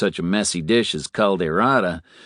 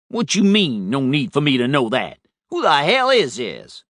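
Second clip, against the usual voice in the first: irritated; 7 semitones higher, 4 dB louder than usual. Acted. Stranger on phone provokes him.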